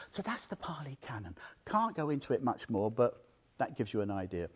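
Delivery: hushed whisper, just for the listeners; whispered aside. Real, not acted. Teacher whispers an aside to his audience, hushed. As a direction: drop to a whisper for a quick aside.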